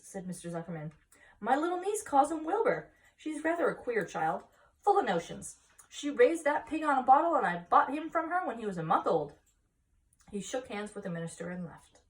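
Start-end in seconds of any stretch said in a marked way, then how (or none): 1.4-9.3 proud telling. Acted. Farmer telling minister.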